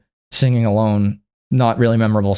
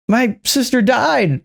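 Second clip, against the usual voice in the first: mock Elphaba; 11 semitones higher, much slower than usual. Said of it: "Elphaba's like".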